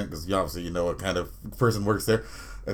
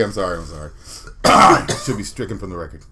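usual speaking voice

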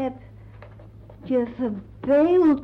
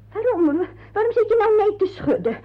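exasperated alarm; 9 semitones higher, faster than usual. Acted. Aunt upset as girl refuses.

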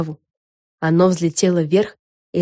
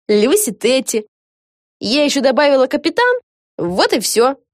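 cheerful fan Lucy; 7 semitones higher, 3 dB louder than usual. Acted. Lucy explains her sea name to Vega, cheerful. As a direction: explain it breezily, high and stretched out.

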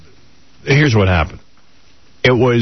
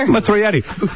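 hammed-up threat to an uncooperative friend; retold outburst; 11 semitones higher, much faster than usual. Dad demanding friend answer the kids, hammed-up anger.

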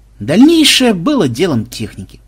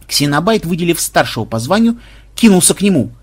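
ordinary delivery

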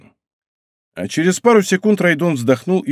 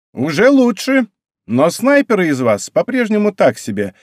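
raised, pompous pronouncement; self-important; 7 semitones higher than usual. A narrator's reading. Рейка rates her snowball snipers, self-important. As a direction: judge the troops like an important commander. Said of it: "важно произнесла Рейка".

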